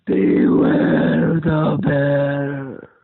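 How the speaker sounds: very loud, very slow roar; slow thundering bear boom. Acted. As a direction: roar it right in their faces. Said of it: "And closer".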